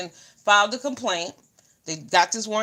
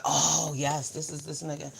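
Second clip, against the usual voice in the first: quiet smug confirmation; 5 semitones lower, 6 dB quieter than usual. Retold or imitated. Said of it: "I said".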